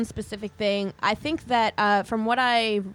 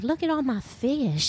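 Jesus voice; 4 semitones higher than usual. Retold or imitated. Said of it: "Jesus's whole thing was like".

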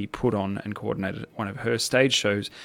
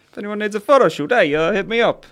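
mock sales pitch; 10 semitones higher, 6 dB louder than usual. Jokey plug for photo shoots.

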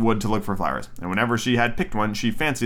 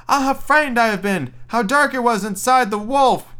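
put-on frightened little girl; 10 semitones higher, 3 dB louder than usual. Girl cries out from the wolf.

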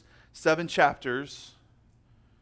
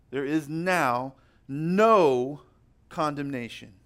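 drawn out slowly, weight on no; very slow, stressed NO; slower than usual. Acted. Preacher to the congregation, hammering home no condemnation. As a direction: spell it out word by word.

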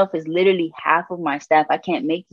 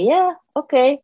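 skeptical boss; 10 semitones higher than usual. Retold or imitated. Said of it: "He said".

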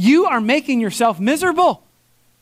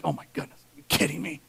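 much softer, lower, under the breath; disbelieving aside; 5 semitones lower, 11 dB quieter, much faster than usual. Retold or imitated. Pastor reacting to the gentleman, hushed disbelief. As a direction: mutter it to yourself. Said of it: "I'm like".

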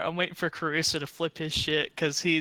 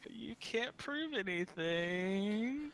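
very slow, soft and high; slow mock denial; 4 semitones higher, 8 dB quieter, much slower than usual. Teasing the guards in a slow soft mock denial. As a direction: drag it out like a smug suspect.

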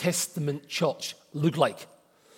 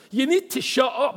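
higher, louder, commanding order to be silent; mock stern command; 9 semitones higher, 4 dB louder than usual. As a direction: bark it like an authority forbidding them. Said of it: "not suggested".

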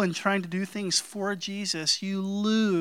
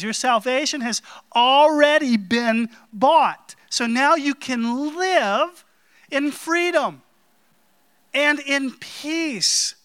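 loud, slowing preaching climax; 6 semitones higher, 5 dB louder than usual. Acted. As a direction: build the sermon to a peak. Preaching at full force.

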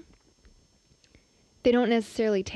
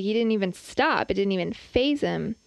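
ordinary talk, nothing marked

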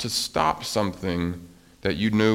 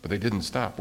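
plain reading voice

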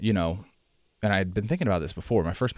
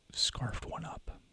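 whisper; 6 semitones higher, 10 dB quieter, much slower than usual. Acted. Gruesome detail about the dog.

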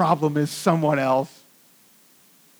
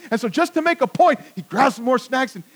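ordinary talk

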